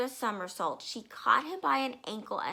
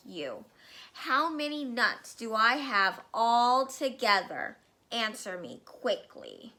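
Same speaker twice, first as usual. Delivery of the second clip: bullying headmistress; 5 dB louder than usual. Acted. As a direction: bark it like a tyrant headmistress. Trunchbull berates Wilfred.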